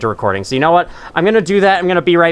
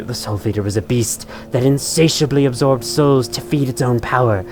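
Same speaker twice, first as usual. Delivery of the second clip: soft, low, ominous narration; dramatic lore reading; 3 semitones lower, 4 dB quieter than usual. Acted. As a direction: read it like eerie lore. Reads item lore aloud.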